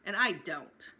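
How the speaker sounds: ordinary delivery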